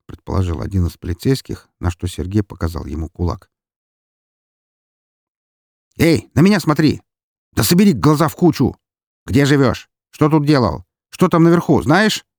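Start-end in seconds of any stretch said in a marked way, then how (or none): 6.0-12.3 high, loud volley of questions; impatient interrogation. A narrator's reading. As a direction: fire demands at an unresponsive suspect. Officer fires questions at drunk.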